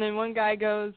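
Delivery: ordinary talk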